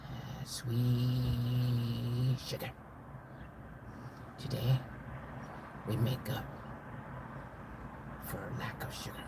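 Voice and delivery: low, soft near-whisper; hushed mock drama. Sugar makers making up for lack of sugar, hushed. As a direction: murmur it low, savoring each phrase.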